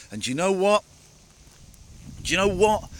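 loud, rising rhetorical question; emphatic. Real, not acted. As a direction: build it up to make a point. Building to an emphatic point.

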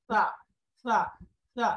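"সা" with tongue slightly out, th-like; tongue-tip sa. Shown as a demonstration. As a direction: drill "সা" with the tongue tip poking out. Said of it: "জিহ্বাটা একটু যদি বের হয়".

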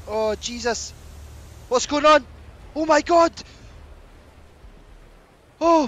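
high, loud panic; panicked. Real, not acted. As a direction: cry out as if trapped by rising danger. Player panics as water rises.

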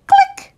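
high bright camera click. Acted. Reader makes the camera's click sound. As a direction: chirp the click like a tiny shutter.

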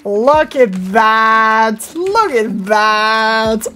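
very slow, stretched-out amazement; drawn-out awe. Real, not acted. Gamer gazing at his rare moose, drawn-out awe. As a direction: linger on every word in wonder.

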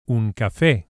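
"café" with the end syllable stressed; "un café", kaFÉ. Shown as a demonstration. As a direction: land on fé in "café". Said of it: "we emphasize the e vowel".

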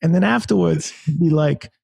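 high-pitched bad boxer impression; Mike Tyson. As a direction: badly imitate a famous boxer, holding the voice way up high. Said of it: "that's my terrible Mike Tyson impression".

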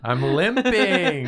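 high, loud, drawn-out holler; hammed-up pedestrian protest; mock indignant yell. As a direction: wail it slowly at an impatient driver. Riffing a pedestrian's yell at driver.

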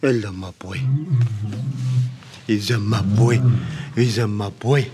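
low, cooing greeting; affectionate pet talk. Real, not acted. Keeper greeting his boy, low affectionate cooing. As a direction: coo it low to a big beloved animal.

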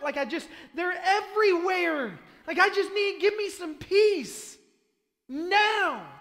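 loud, climbing, exasperated yell; desperate ranting. Acted. Frog-covered man begging Moses for peace now, desperate yelling. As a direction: build to a high, drawn-out shouted plea.

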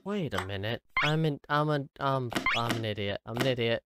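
ordinary talk, nothing marked

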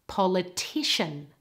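"Politician" with stress on the third part; third-syllable stress. Shown as a demonstration. Teacher shows the stress moving, third-syllable stress on "Politician". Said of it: "stress moves to the third part".

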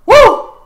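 loud high-pitched whoop; short piercing cheer of hype; excited whoop. Real, not acted. Hyped about the animation.

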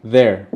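"their" punched out alone; pronunciation demo. Shown as a demonstration. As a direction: model "their" loudly and clearly for learners. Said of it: "Se pronuncia así".

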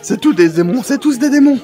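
panicked shout. Acted. Zenitsu yelling at the others, panicked shout. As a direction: scream that they are all demons.

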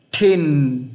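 "Thin" drawn out, falling onto a long n; pronunciation model. Shown as a demonstration. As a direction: model "Thin" slowly with an airy th. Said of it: "Phát âm như thế này này".

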